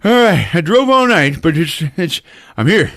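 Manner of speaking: high, loud breathless arrival; mock hurried hero. Jim bursting in after driving all night, mock hurried. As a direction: burst in flustered. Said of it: "And Jim busts in".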